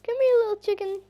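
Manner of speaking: very high, loud coaxing call; calling a pet. Real, not acted. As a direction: coax the chicken over in a squeaky shout. Calling chicken to come.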